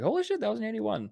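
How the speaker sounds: 1 surprised. Retold or imitated. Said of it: "I was like".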